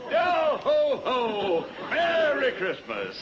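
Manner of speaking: booming high Santa ho ho; jolly Santa. Acted. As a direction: belt it out like Santa. Santa greeting everyone.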